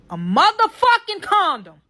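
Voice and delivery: loud, high-pitched disbelief; outraged reveal. Retold or imitated. Telling viewers about the find, outraged. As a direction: hit the reveal hard, voice shooting up.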